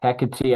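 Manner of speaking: Hecate as HEK-uh-tee; Greek-style pronunciation. Shown as a demonstration. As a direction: give Hecate three syllables, ending in "tee". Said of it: "is more proper for the pronunciation of Greek".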